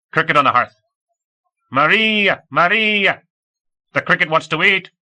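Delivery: high, loud ringing call; shouting out. A narrator's reading. Kid reliving remembered words, shouting them out. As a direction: call out loudly, voice pitched right up. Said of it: "The kid shouted the words out in a rich baritone".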